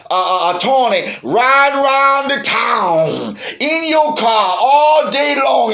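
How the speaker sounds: ordinary talk, nothing marked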